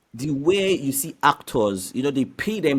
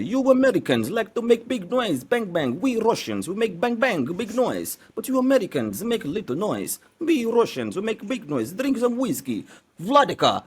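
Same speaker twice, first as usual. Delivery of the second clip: hammed-up Russian accent, pitched higher; Russian man impression; 8 semitones higher than usual. Shown as a demonstration. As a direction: boast like a big Russian man in a heavy Russian accent. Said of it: "can you talk like, uh, a Russian man".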